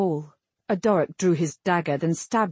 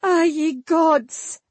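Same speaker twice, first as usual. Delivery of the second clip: high, drawn-out cry of dismay; bursting out; 9 semitones higher, 4 dB louder, slower than usual. A narrator's reading. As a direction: burst out as the distraught queen. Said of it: "Elena burst out".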